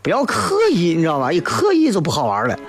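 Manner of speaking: ordinary talk, nothing marked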